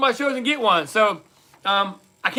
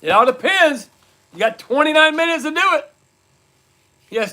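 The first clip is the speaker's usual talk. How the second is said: ordinary talk, nothing marked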